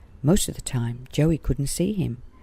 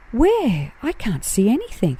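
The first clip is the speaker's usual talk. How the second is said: high, eager exclaim; straining to spot her, eager; exclamation; 8 semitones higher than usual. Acted. Joey searching the branches, high eager exclaim. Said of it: "exclaimed Joey".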